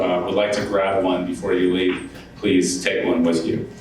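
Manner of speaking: ordinary talk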